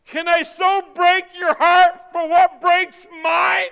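loud, high sermon climax building to a shout; impassioned shouted plea; full-force preaching. Real, not acted. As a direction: drive each question harder, stretching the last words.

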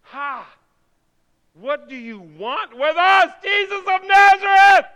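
high, drawn-out demonic shriek; possessed howl, building to full volume; screaming evil spirit. Retold or imitated. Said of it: "somebody with an evil spirit says".

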